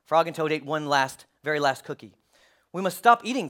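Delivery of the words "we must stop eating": raised, louder plea; urgent cry. A narrator's reading. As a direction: cry out in mild alarm. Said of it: "cried Toad".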